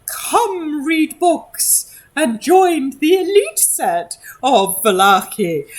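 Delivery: high, slow, grand society lady; haughty puppet lady. Acted. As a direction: announce it like a snooty noblewoman.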